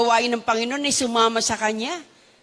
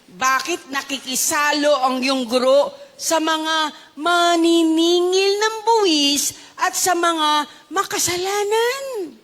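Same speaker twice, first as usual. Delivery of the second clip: slow, drawn-out reading of the question; slow quoted question; slower than usual. Acted. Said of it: "Ano ang sabi?". (mabagal at madiing pagbasa ng tanong)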